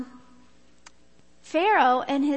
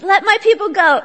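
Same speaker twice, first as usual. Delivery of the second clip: loud, higher-pitched demand; commanding Moses; 4 semitones higher, 10 dB louder than usual. Acted. Moses confronting Pharaoh, loud and commanding. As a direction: proclaim it forcefully, well above talking level. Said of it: "recruits him to confront Pharaoh and say".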